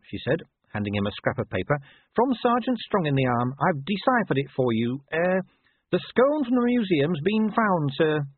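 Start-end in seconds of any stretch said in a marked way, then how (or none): 2.1-8.2 a lightly voiced, higher, careful subordinate reporting; a hint of a nervous corporal delivering news; dutiful young corporal.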